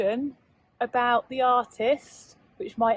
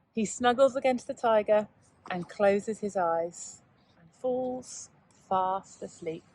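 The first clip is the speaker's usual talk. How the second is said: bedtime story ending; 3 semitones lower than usual. A narrator's reading. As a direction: let it drift off to sleep. Story ending, drifting to sleep.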